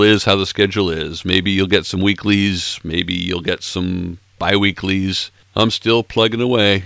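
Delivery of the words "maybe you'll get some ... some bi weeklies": normal talking voice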